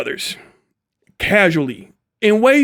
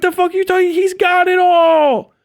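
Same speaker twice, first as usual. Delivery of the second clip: shout; 9 semitones higher than usual. Real, not acted. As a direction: yell it out in disbelief. Ranting about Asmongold's attitude.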